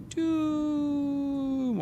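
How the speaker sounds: put-on drawn-out high drunken "Doom"; drunk college kid. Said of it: "You guys say it like a drunk college kid".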